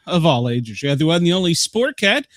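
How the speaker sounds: loud, high showman intro to the crowd; announcer hype. Acted. Host opens show to listeners in a hyped announcer voice. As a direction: boom it out like a ringmaster.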